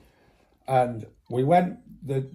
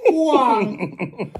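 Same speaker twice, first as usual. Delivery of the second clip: whooshing car noise; 9 semitones higher, 5 dB louder than usual. Retold or imitated. Mimicking car speeding past.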